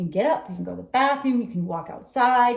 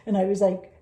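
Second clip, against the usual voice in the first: ordinary delivery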